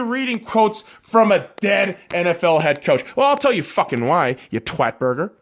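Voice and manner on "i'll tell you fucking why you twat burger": ordinary talk, nothing marked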